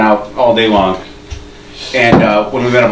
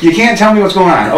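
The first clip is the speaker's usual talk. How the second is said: higher-pitched acted indignation; exasperated sarcasm; 7 semitones higher than usual. Storyteller confronts his silent drunk friend in the hallway, exasperated sarcasm. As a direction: throw the friend's excuse back at him, voice pitched up.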